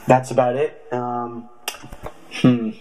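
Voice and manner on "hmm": normal talking voice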